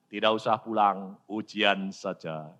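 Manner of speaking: low, unhurried divine answer; calm acted God. Voices God's calm answer.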